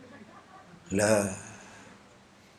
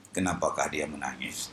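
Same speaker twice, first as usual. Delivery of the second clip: blind man voice; 11 dB quieter than usual. Retold or imitated. Blind Kasim voiced in story.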